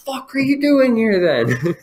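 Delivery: high, loud, incredulous outburst; exasperated God. Acted. God scolding an atheist caller, high incredulous outburst.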